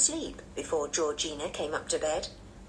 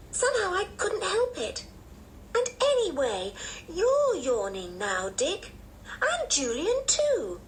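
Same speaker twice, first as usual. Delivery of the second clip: put-on defensive little girl; 11 semitones higher than usual. A narrator's reading. Anne turning the yawning on Dick, high defensive child. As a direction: answer back high and childlike, stretching the key words.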